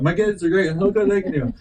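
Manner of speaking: high mock-gushing parent voice; imitated proud parent. Parent showing off messy kids, imitated high-pitched gushing. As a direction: play a doting parent showing off the children. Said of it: "they always say that".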